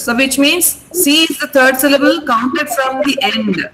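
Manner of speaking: usual speaking voice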